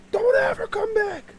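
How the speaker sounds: high, strained holler; shouted warning. Retold or imitated. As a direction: yell it after a fleeing intruder. Shouting after fleeing squirrel.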